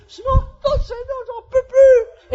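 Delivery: very high, slow, hammed-up wail; desperate wail. Driver stuck in village traffic, wailing in despair. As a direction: wail it out, stretching every word.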